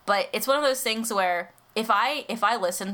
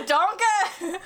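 high, hammed-up Scottish ogre call; Shrek; 11 semitones higher than usual. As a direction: squawk Donkey in mock Scottish. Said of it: "I'll sound like Shrek".

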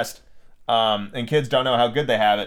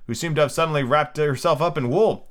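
usual speaking voice

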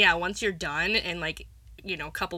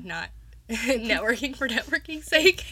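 amused, higher-pitched through laughter; laughing; 9 semitones higher, 4 dB louder than usual. Real, not acted. Co-host finishing her friend's thought, laughing. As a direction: laugh your way through the phrase.

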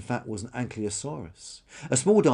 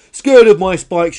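high, very loud, slowed outrage; full indignant shout; 9 semitones higher, 18 dB louder, slower than usual. Acted. Dinosaur repeats the insult even louder, high outraged shout. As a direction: belt it out, higher and outraged. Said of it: "shouted Karen".

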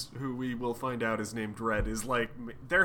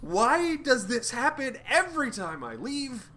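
much higher, whiny indignation; exasperated complaint; 11 semitones higher than usual. Acted. Odin complaining about mortals every time he leaves, exasperated. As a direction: complain to the ceiling. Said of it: "Odin turns around and goes".

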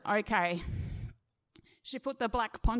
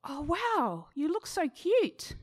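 slow, higher surprised praise; 4 semitones higher, slower than usual. Retold or imitated. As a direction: act caught off guard by the result. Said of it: "she sounded surprised".

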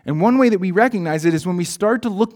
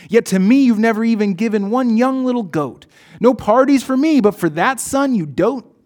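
louder and higher, bitter complaining outburst; fuming older son; 4 semitones higher, 5 dB louder than usual. Acted. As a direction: hurl his grievance at father, building in anger. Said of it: "the older son was still fuming".

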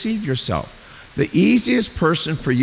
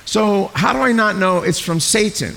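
doubting believer; 6 semitones higher than usual. Retold or imitated. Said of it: "like, you say".

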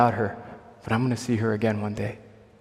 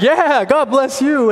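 much higher, louder, slow and beaming; excited; 14 semitones higher, 8 dB louder, slower than usual. Retold or imitated. Answering the Chicago lady, an excited high loud blessing. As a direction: burst out with joy, drawn out. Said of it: "And I got excited".

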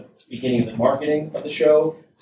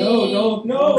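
high, loud mock alarm; mock panic; 11 semitones higher, 6 dB louder, slower than usual. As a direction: pretend to panic playfully. Mock kidnapping victim protest.

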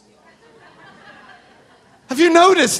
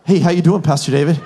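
close talker impression; 8 semitones lower than usual. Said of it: "they're like".

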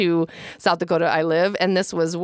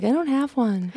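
imitating a friend in higher pitch; pitched-up quote of a friend; 6 semitones higher than usual. Friend declines the mom moment, imitated. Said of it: "Nicole's like".

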